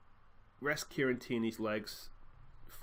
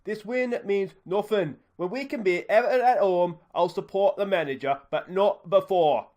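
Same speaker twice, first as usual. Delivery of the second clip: angry fan; 6 semitones higher, 9 dB louder than usual. Acted. Angry fan rants at reporter.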